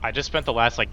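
ordinary talk

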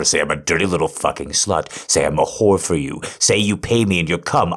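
rapid, pushy dirty talk; hurried, insistent demand. Shown as a demonstration. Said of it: "Say it faster".

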